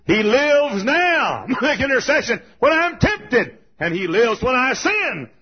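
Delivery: high, loud, drawn-out proclamation; impassioned pulpit shout; full-force preaching. Real, not acted. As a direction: shout the promise to the congregation.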